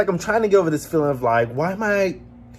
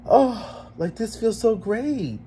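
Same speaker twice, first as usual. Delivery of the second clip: high, drawn-out sigh of pleasure; blissful relief; 7 semitones higher, much slower than usual. Retold or imitated. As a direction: savour it slowly, voiced as an imagined reaction. Imagined reaction to time with friends.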